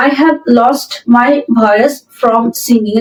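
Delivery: very slow, word by word dictation; slow model sentence. Shown as a demonstration. Teacher reading the example sentence aloud to students, very slow and clear. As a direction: spell it out slowly for learners.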